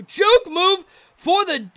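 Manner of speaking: high, loud and slow, stretching every word; drawn-out excited call. Real, not acted. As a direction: savour the juke like a highlight. Calling a juke move.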